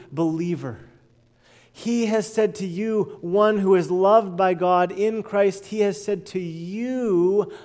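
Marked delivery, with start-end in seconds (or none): none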